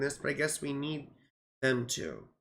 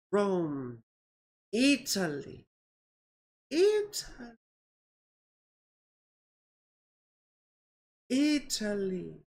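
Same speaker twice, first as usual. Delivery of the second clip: playful Italian flourish; slower than usual. Pretend. Vlogger to viewers, savoring Italy with a playful flourish. Playful singsong place name.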